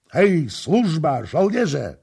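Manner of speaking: loud, high, drawn-out call; powerful shout. A narrator's reading. Prince suddenly summoning servants and soldiers, powerful shout. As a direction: bellow out a summons with full force. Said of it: "zawołał potężnym głosem".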